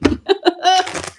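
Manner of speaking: short, high giggle; self-amused laugh. Real, not acted. Streamer on her country twang, high laugh.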